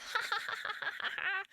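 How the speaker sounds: squeaky high-pitched giggle; a cartoonish little giggle. After squeaky mock line.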